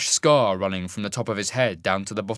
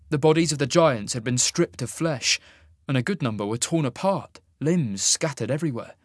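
usual speaking voice

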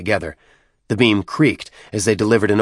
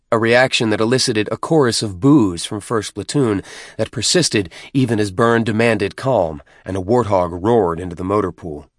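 usual speaking voice

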